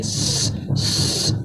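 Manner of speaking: س as a soft hiss, breath only; whistling S. Shown as a demonstration. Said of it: "سیٹی کی آواز ہوتی ہے". (س کی سیٹی والی آواز)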